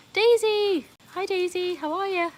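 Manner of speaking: excited greeting. Real, not acted. Streamer welcoming Daisy to the live, excited high greeting. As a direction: call out her name like a friend arriving.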